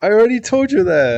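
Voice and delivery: high, loud mock exasperation; exasperated exclaim. Real, not acted. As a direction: throw up your hands, loud. Teasing viewer, exclaiming.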